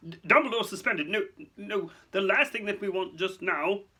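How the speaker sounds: high, stammering official; alarmed. Acted. Fudge objecting to Dumbledore's suspension, alarmed. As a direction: splutter out objections. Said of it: "looking alarmed".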